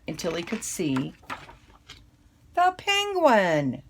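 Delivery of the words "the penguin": much higher, louder and slow; the big happy reveal, drawn out; delighted reveal. A narrator's reading. Reader revealing the found penguin to children, thrilled and drawn out.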